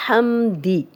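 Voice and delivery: ordinary talk, nothing marked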